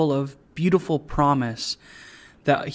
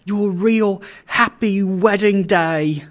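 slow, higher, savoring every word with joy; joyful cry; 5 semitones higher, 4 dB louder than usual. A narrator's reading. Father beside himself with joy to his daughter, joyful cry. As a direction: draw it out in delighted exclamation. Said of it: "cried Trotty".

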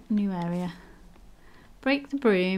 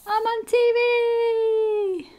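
excited shout; 7 dB louder, much slower than usual. Real, not acted. Gasp, thrilled at TV.

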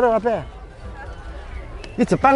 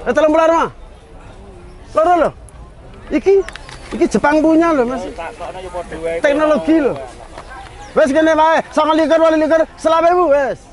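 hawker price call; 8 semitones higher than usual. Real, not acted. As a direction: shout the bargain to the crowd. Vendor auctions lamp, dropping prices. (nawakake rega banter lan dhuwur)

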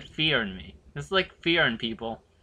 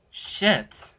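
normal talking voice

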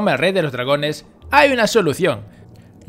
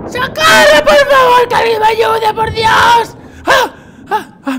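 hammed-up high shrieks, much louder; mock screaming; 9 dB louder, slower than usual. Gamer inside the dragon's belly screaming high for help. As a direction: scream for help like it's the end.

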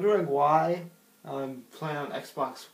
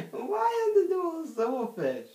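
very high, baffled squeal; surprised; 5 dB louder than usual. Real, not acted. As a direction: squeak it out in disbelief. Finds silverfish everywhere.

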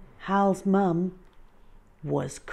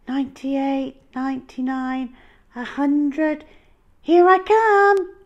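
counting up then loud high call; 11 semitones higher, 11 dB louder than usual. Acted. Hal ending the count, calling loudly. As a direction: call out to the hiders. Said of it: "called Hal".

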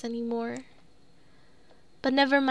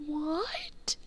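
whisper; 3 semitones higher, 5 dB quieter than usual. Real, not acted. Shocked disbelief.

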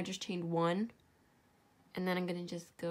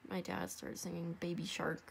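ordinary talk

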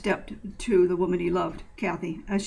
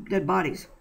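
ordinary delivery, nothing marked

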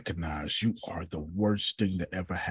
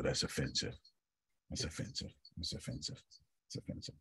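fading to a whisper; 8 semitones lower, 15 dB quieter, faster than usual. Pretend. Mock self-censure to the group, fading to a whisper. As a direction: repeat it fading from quiet to an unvoiced whisper. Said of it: "fading repeat into whisper".

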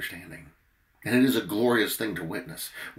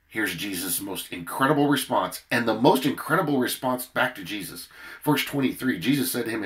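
usual speaking voice

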